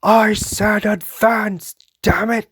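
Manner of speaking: high, loud, slow screamed command; screamed order. Retold or imitated. Imitating Irvin screaming at soldiers while carried off. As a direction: scream it through the pain. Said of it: "he just screams".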